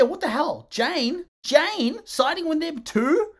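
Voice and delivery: can't believe it; outraged disbelief. Real, not acted. Reviewer can't believe Jane sides with the bullies, rising outrage.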